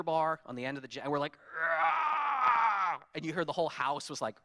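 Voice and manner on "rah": mock strain yell; straining grunt. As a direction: heave on the cheater bar. Straining on the jack.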